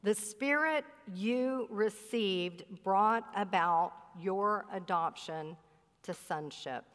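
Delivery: deliberate word by word, dropping away at "sonship"; slow, weighty rereading. Shown as a demonstration. Preacher rereads the key verse to the congregation, slow and weighty.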